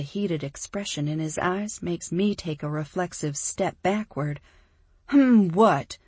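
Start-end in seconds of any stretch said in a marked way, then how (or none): 5.1-6.0 sharp challenge. Acted. Heroine snaps back at him.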